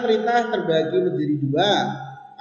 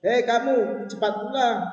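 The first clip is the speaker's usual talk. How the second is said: high-pitched command; high intonation; 7 semitones higher than usual. Shown as a demonstration. Teacher shows intonation of a spoken order, high-pitched. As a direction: call out an order in a raised pitch. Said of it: "Nah, itu kan tinggi kan?".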